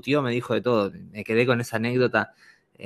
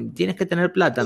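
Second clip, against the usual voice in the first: high, pleading street seller; high wheedling hustler voice; 8 semitones higher than usual. Retold or imitated. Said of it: "me decía".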